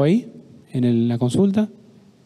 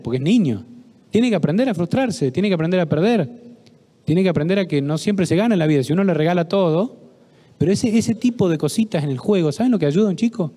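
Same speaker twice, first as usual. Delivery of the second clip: normal talking voice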